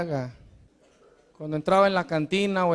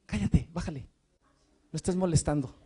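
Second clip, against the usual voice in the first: hushing disciples; 5 semitones lower, 6 dB quieter than usual. Retold or imitated. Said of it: "los discípulos".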